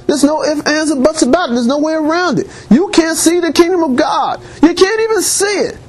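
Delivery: high-pitched, insistent preaching; urgent preacher pressing the point, pitch climbing; emphatic insistence. Real, not acted. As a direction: drive each point home with pitch held high. Preacher insisting there is no exception.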